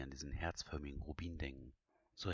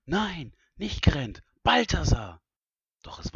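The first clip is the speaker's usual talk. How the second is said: loud, high, drawn-out cry of alarm; horrified shout; 14 semitones higher, 18 dB louder, slower than usual. Acted. Princess realises she pressed the wrong symbol, horrified shout. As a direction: shout it in panic, far above normal pitch.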